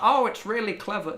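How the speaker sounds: high-pitched mock admiration; imitating admirers. Imitating people who excuse his rambling, high mock admiration. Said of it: "people are like".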